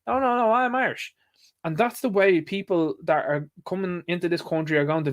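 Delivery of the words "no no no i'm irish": much higher mock Biden, protesting; Joe insisting. Mocking the American president insisting he is Irish: high put-on voice. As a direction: whine "no, no, no" in a put-on old voice. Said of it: "He's like".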